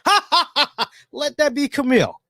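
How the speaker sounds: high-pitched mock laugh carried into speech; Poppy impression. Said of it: "Poppy, Poppy Lab said".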